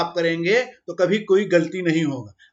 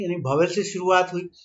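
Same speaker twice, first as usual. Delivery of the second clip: ordinary talk, nothing marked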